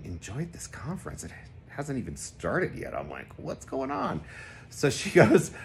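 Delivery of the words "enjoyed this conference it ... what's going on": soft, confused self-talk; a quiet puzzled aside to yourself; puzzled inner thought. Retold or imitated. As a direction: murmur it under your breath baffled.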